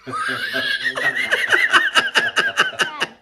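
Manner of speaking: high-pitched burst of laughter; real laugh. Real, not acted. Teller ends the barrel joke, laughing hard. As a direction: crack up after a punchline.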